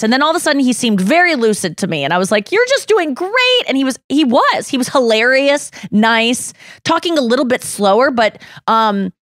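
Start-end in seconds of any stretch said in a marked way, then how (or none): 2.5-3.6 reassuring. Retold or imitated. Retold encouraging line to Jeremy.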